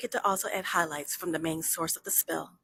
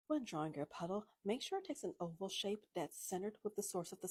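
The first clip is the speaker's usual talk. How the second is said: soft, breathy whisper, much softer, close to the mic; ASMR whisper; 12 dB quieter than usual. Acted. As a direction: whisper the steps gently like an ASMR tutorial. Whispered drawing tutorial.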